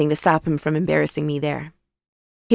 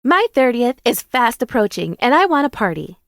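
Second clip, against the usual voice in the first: high-pitched announcement to friends; announcing; 7 semitones higher, 4 dB louder than usual. A narrator's reading. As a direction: declare it high and bright-eyed, demanding attention. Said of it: "Jada announces".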